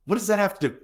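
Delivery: high, thrown-off protest; indignant confusion; baffled protest. Retold or imitated. Flustered witness asking an officer why it matters, high and baffled.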